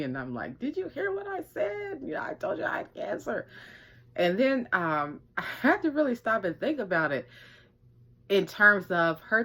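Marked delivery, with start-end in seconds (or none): 0.6-3.5 high, incredulous retort with a breathy end; can you believe this kid; pitched way up in mock outrage; indignant disbelief.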